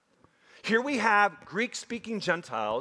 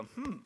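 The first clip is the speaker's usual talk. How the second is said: puzzled hum; 4 semitones higher, 11 dB quieter than usual. Retold or imitated. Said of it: "You go".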